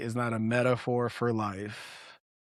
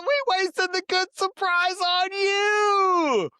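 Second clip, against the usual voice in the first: high, slow, drawn-out movie-character delivery; hammed-up movie quote, high and slow; quoting movie line; slower than usual. Quoting kid's favorite movie scene.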